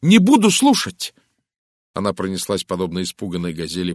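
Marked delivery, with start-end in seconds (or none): 0.0-1.2 high, loud refusal; agitated exclamation. A narrator's reading. Horrified Linda refuses to hear Poirot, crying out. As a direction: cry out in horror, shutting him out. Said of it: "воскликнула Линда".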